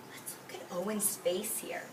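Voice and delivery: hushed whisper after a gasp; whispered aside. Acted. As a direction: lean in and whisper to the kids.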